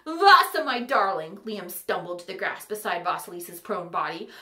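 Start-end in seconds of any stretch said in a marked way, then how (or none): 0.1-1.4 loud, high wail of her name; anguished cry. A narrator's reading. Liam crying out over Vasilisa, loud anguished cry. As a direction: cry out to a fallen love. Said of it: "the true Fitzwilliam's cry".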